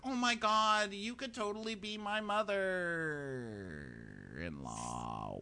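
drawn-out, high-pitched mock emotion; mock gushing wail. Girlfriend to her boyfriend's mom, mock gushing.

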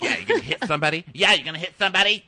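imitating a rapid-fire challenge; mock goading. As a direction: goad him into a fight, hammed-up. Acted goading quarrel.

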